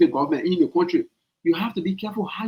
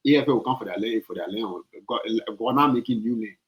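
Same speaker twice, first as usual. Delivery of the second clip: a low, dragging imitation of the president; lightly voiced mock of a careless leader; 7 semitones lower, 3 dB quieter than usual. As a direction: mimic him as if he doesn't care. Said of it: "Who talk like that?".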